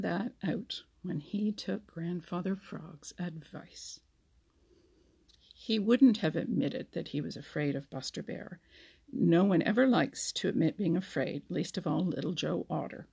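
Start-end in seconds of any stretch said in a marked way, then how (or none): none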